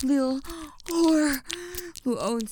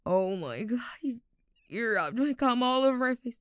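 ordinary talk

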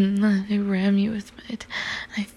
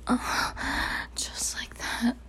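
ordinary talk

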